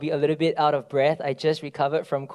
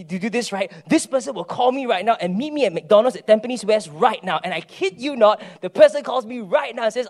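louder, higher, animated storytelling; excited preaching; 4 semitones higher, 7 dB louder than usual. Acted. Pastor excitedly tells the church about ten signs.

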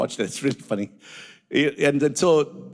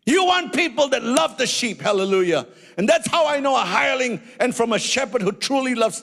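raised preaching voice; fervent preaching; 7 semitones higher than usual. Acted. Preacher to church on hirelings, fervent preaching. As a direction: press the point home.

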